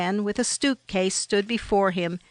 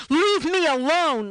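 higher, mildly raised yell; narrator's contained cry to be left alone; restrained yell; 7 semitones higher than usual. A narrator's reading. Traveler yells at two thieves to draw drivers' attention, restrained yell. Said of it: "and yelled".

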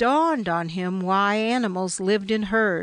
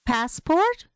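high, rising, incredulous echo; stunned; 7 semitones higher than usual. A narrator's reading. Stunned traveler echoes the hostel clerk's request, surprised. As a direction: ask it as if caught off guard. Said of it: "Brent was stunned".

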